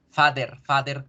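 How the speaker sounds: "father" as FAH-der, hard d and sounded r; old Spanish-style pronunciation. Shown as a demonstration. Teacher mimics how students said it before, Spanish-style pronunciation. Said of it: "como lo decíamos antes".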